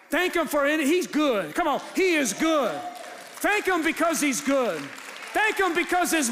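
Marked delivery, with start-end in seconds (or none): none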